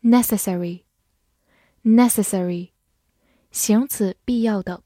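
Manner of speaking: ordinary delivery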